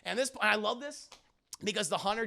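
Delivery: ordinary talk, nothing marked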